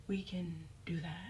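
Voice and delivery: low, very soft, understated threat; hushed challenge. Retold or imitated. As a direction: drop to a near-whisper. Acted quiet offer to fight.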